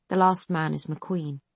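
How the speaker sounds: ordinary delivery, nothing marked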